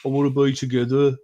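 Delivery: lightly voiced Brummie advert line; a quick hint of a Brummie accent, quoting the advert. Quoting an old TV advert, in a light Brummie accent. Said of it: "yeah, in a Brummie accent".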